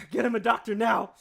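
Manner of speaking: loud mock-emergency call for help; urgent shout. Acts out bystander to coughing fit.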